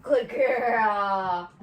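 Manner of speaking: loud, gushing praise for the dog; big happy dog-praise voice; excited praise. Real, not acted. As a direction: cheer her on like she just won. Praising dog after fetch.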